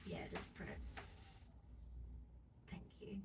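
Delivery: hushed whisper through a cramp; whisper, strained by the cramp; whispering in pain. Real, not acted. Foot cramp being pressed.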